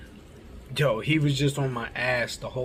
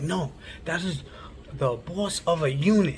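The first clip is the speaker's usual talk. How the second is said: imitating an indignant correction, pitched higher; retold scolding; 4 semitones higher than usual. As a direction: set them straight in a raised-pitch mock scolding. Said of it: "like, yelling at me".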